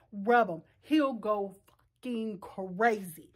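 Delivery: ordinary talk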